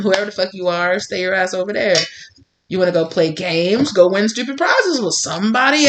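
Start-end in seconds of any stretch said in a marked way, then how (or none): none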